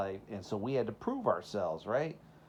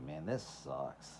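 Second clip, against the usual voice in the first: muttered low and much softer, glum; moping kid; 8 semitones lower, 10 dB quieter than usual. Retold or imitated. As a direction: sulk it under your breath like a fed-up kid. Kid's dismay quoted, much quieter.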